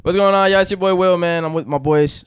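big booming hello to the crowd; hyped self-introduction. Real, not acted. Will introducing himself and his boys, loud and hyped.

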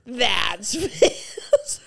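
drawn-out, strained mock shout, laughing; loud meme quote. Callback joke to puzzled listeners, loud mock wail. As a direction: blurt the clip loudly through a laugh. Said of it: "So fucking loud".

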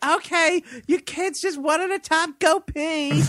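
high-pitched mock mom voice; imitated mother. Mom lets kids in one at a time, high mock-mother voice. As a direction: raise the pitch, play a mom directing her kids.

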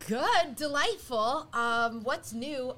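loud, slow and cheerful. Real, not acted. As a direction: sing out how good you feel. Cheerful greeting reply.

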